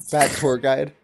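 ordinary talk, nothing marked

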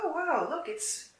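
high, airy exclamation of surprise; surprised greeting. Acted. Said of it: "Chokan's surprised to see him".

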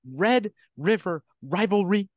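"Red River rivalry" called out high like a TV announcer. Shown as a demonstration. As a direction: hype it up like a broadcast intro.

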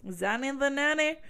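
repeating Zanny, the nanny as a silly rhyme; singsong. Shown as a demonstration. Telling viewers the rhyme, playful singsong. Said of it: "that's where my brain goes".

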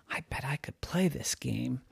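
soft secret whisper. Acted. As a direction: whisper the plan to yourself. Deer plotting quietly.

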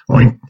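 a short snorting pig oink. Acted. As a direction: grunt it like a real pig. Boy hides in pig pen.

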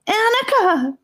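put-on boy screaming for his sister's help; crying out. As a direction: call her name in panic. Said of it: "Gabe cried out".

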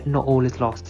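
usual speaking voice

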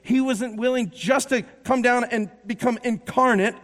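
loud, driving sermon delivery; full-force preaching. Acted. Preacher rousing the congregation about Jesus, full-force preaching. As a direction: preach it out to the whole room.

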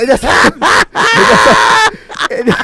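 high, loud venting broken by laughter; worked-up venting. Real, not acted. Aggrieved host venting about an absent colleague, worked up. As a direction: vent loudly, voice jumping up. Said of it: "Aquí se están desahogando ellos".

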